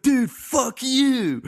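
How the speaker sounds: high, slow, squealing mock fury; mock angry outburst. Retelling the art guy's angry reply, hammed-up high outrage.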